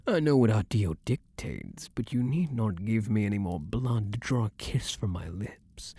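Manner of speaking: ordinary talk, nothing marked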